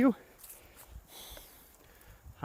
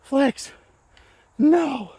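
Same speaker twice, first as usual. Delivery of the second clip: yelling at the disc; 13 semitones higher, 8 dB louder than usual. Real, not acted. Yelling at his flying disc.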